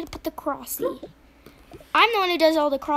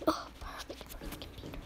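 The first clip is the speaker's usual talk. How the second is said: soft unvoiced whisper; quiet whispered remark; whispered aside; 15 dB quieter than usual. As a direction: whisper it to yourself. Whispered aside while playing.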